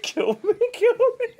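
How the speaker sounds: high laughing plea. Real, not acted. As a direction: gasp it out through laughter. Laughing despair.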